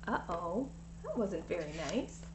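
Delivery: put-on soft, tut-tutting aside to the kids; hushed aside. A narrator's reading. Reader to the children, a hushed disapproving aside. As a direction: drop to a gentle scolding murmur.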